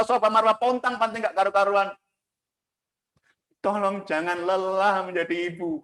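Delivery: high, pleading sermon voice, rising to urgent appeals; full-force preaching about Hajar's struggle; impassioned preaching. Acted. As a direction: plead with the mothers not to give up.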